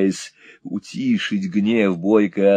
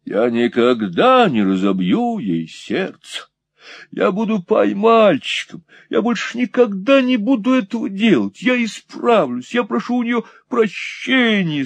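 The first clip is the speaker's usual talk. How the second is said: high, whining plea; high whimpering apology to his wife; pleading; 10 semitones higher than usual. Acted. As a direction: beg her forgiveness, high. Montalini pleading for forgiveness.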